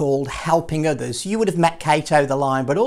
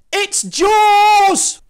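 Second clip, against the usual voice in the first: mock-terrified shout; 9 dB louder than usual. A narrator's reading. Frightened disciple guessing again.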